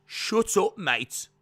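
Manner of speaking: hammed-up Birmingham command, weightier and falling; Pete Dunne. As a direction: put depth into a loud mock promo voice. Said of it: "depth to my voice to get to Pete Dunne".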